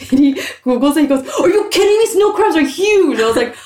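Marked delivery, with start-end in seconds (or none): 1.4-3.2 very high mimicry of an indignant brother; mock incredulous outburst, pitched way up; heated comeback. Sister imitating her brother arguing about crabs, heated comeback. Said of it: "at this point we're both heated".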